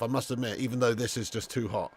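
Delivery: ordinary talk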